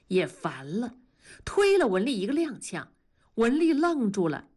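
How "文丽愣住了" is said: ordinary delivery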